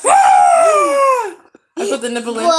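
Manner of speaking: high, slow words through loud laughter; laughing. Real, not acted. Playing the nipple game with the baby, laughing loudly. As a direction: squeal it out mid-giggle.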